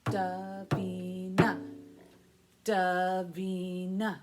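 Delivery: Davina split into three slow even beats; Da-vi-na said slowly, one beat per syllable; syllables tapped. Shown as a demonstration. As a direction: clap out each syllable of Davina. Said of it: "How many beats in my name?".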